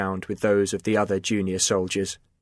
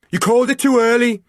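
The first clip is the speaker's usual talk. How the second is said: much higher, louder defiant shout; shouted back; 12 semitones higher, 6 dB louder than usual. A narrator's reading. Chris shouting back at his commander, defiant shout. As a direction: hurl the excuse back. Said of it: "Chris shouted back".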